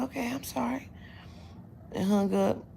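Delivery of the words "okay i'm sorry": small, soft, higher voice; meek apology. Retold or imitated. Caller apologizing for ringing early, soft and meek. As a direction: shrink into a sheepish little apology.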